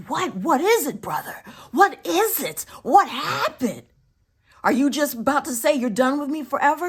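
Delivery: high, loud retold panic; alarmed urgency. Sister answering brother's urgent call, acted alarm. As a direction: demand answers, building into a rapid question. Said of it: "with such a sense of urgency".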